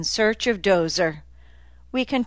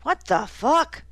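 pained, higher-pitched groan of protest; 8 semitones higher than usual. A narrator's reading. Dozer dumped on the ground from his hammock, groaning. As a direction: groan it out after a hard fall. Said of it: "he said, groaning".

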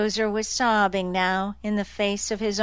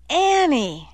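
high, loud alarmed call; 7 semitones higher, 4 dB louder than usual. Acted. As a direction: call her name in alarm. Boleyn alarmed, calling Annie.

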